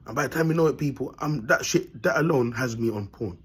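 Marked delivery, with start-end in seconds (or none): none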